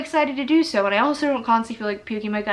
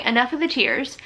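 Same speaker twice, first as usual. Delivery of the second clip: ordinary delivery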